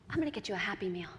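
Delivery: soft, low, quick murmur; hushed motherly offer; quiet treat reveal. Acted. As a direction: gently let the child in on a treat.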